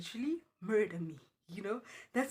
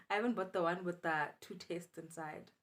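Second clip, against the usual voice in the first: ordinary delivery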